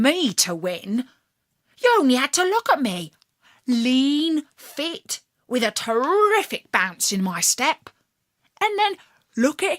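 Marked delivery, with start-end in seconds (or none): none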